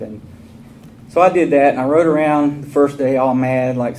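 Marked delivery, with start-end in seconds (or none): none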